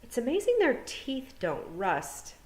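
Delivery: odd calm thought. A narrator's reading. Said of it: "part of Tiffany thought".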